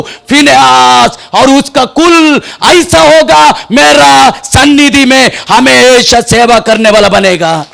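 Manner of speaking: high, loud, stretched preacher's cry; full-force preaching. Acted. Preacher rousing the church about sin, full force. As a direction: thunder it at the congregation. Preaching God's covenant with Phinehas.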